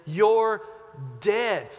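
ordinary talk, nothing marked

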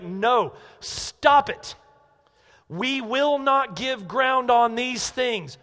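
loud, high, emphatic refusal; slow, forceful line in the sand; vehement refusal. Retold or imitated. Preacher voicing Paul refusing to give ground, vehement. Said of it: "he vehemently attacks them".

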